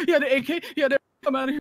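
high, startled questions; surprised. Real, not acted. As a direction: blurt it out in shock. Surprised by teammate.

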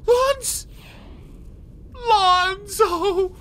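very high, drawn-out call; excited name call. Real, not acted. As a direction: break off, then stretch the name high. Calls out player name.

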